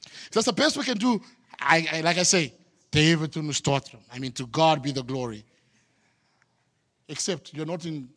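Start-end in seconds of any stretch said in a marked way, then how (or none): 0.1-5.4 mock church boast. Mimics boast again, harder.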